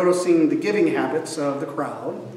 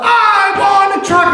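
put-on high, loud whiny little-boy shout; child tantrum; 8 dB louder than usual. Boy shouting at mom in the toy aisle, high loud whine. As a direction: demand the truck like a spoiled toddler.

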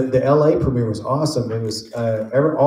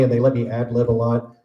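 normal talking voice

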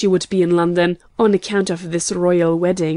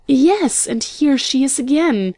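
high, swooping triumph; triumphant cry; 5 semitones higher than usual. A narrator's reading. Young woman finds the princess in another paper, triumphant. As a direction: announce the find in a high, pleased cry. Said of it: "gave another triumphant cry".

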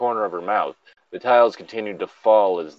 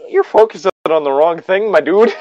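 teasing; 9 semitones higher, 9 dB louder than usual. Real, not acted. Teasing co-host over misreading.

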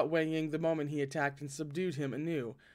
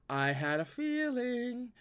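knowing aside; 7 semitones higher, slower than usual. Acted. Lan Zhan's voice, aside.

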